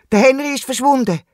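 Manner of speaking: high, distressed confession. A narrator's reading. Wombat tells kangaroo that Henry is missing, distressed confession. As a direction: blurt out the bad news in alarm. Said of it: "beichtet er im Känguru".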